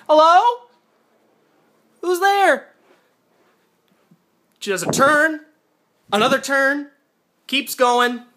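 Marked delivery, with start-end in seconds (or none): 0.1-2.7 nervous calling out. Retold or imitated. Heroine calls into empty house.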